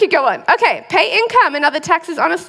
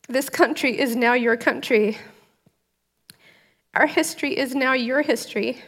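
solemn, measured welcome address read aloud, softer; formal ceremony speech; 3 dB quieter than usual. Acted. New citizen reads the welcome speech to viewers, solemn and moved. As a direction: read it like a judge at a naturalization ceremony, holding back tears.

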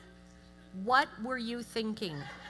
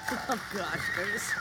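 usual speaking voice

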